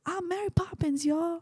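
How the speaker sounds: high, soft mock proud line; playful Yondu impression; Yondu quote. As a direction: imitate Yondu boasting, pitched high and hushed.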